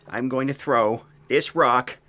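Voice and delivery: usual speaking voice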